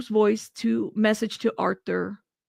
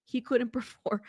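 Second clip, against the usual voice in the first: laughing through it; 9 dB quieter than usual. Real, not acted. Rereads it, breaks into laughter.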